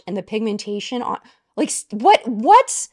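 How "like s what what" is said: loud, high disbelieving what; real stunned shout of wonder; astonished. Real, not acted. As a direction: gasp out the questions in amazement. Stunned by the swatch.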